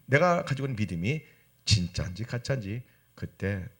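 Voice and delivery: usual speaking voice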